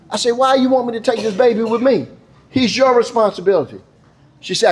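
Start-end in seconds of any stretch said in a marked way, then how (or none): 0.3-3.8 high-pitched, incredulous objection; officer's exasperated comeback; acted protest. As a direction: argue back at the mother, pitch raised. Officer argues with mother.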